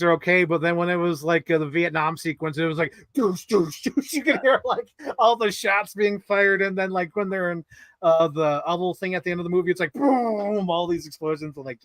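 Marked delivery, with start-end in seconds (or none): 3.1-4.1 short "doosh" gunfire noises; imitated gunshots. Imitating shots in Vietnam sequence. 9.9-10.7 long drawn-out boom, slightly raised pitch; imitated explosion.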